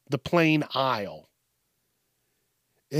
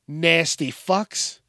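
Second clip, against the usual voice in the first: ordinary delivery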